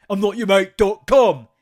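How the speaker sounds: imitating the rude customer, full mock shout; mock yell. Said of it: "he calls him mate accidentally again".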